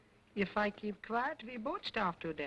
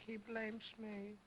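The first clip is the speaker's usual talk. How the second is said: slow, much softer "blames"; muttering; 11 dB quieter, slower than usual. Acted. Repeated under breath.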